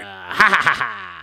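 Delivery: slow, loud, hammed-up Joker laugh; villain cackle. Playing Joker for his friend, slow hammy cackle. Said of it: "I'm the Joker".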